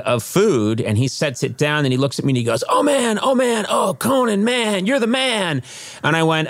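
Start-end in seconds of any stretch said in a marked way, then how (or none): 2.7-5.6 high-pitched imitation of a gushing fan; starstruck fan. Delivery man recognizing a celebrity, mock starstruck. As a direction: gush in a raised voice, mimicking an awestruck admirer.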